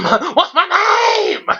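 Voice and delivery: villain screaming her own name; screamed taunt. Retold or imitated. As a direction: shriek it out laughing. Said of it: "and then Uma's like".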